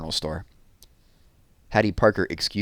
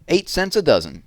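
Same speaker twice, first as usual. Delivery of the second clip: louder, higher price call; vendor's call; 7 semitones higher, 5 dB louder than usual. A narrator's reading. Sam hawking onions from his cart, calling out.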